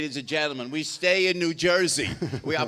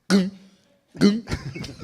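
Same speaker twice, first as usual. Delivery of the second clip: short, thudding engine noise imitation; imitated car noise; 5 semitones higher, 8 dB louder than usual. Comedian shows the car running out of gas, imitated clunking noise. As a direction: voice the car's two dying clunks. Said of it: "the car makes this sound".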